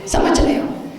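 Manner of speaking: ordinary talk, nothing marked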